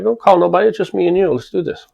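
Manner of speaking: loud, high-pitched dare easing off at the end; retold fighting challenge. Man daring mafia guy to fight alone, heated retold challenge.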